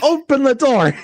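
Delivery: yelling. Retold or imitated. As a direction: shout it high through the door. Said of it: "She's like".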